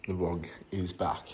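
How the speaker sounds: soft unvoiced whisper. Real, not acted. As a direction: whisper it to the camera. Whispered aside.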